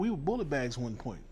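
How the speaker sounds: usual speaking voice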